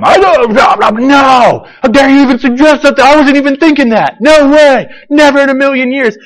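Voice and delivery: high, loud indignant denial; recoil, outraged. Acted. Pharisees indignantly reviling him.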